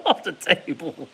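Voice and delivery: ordinary talk, nothing marked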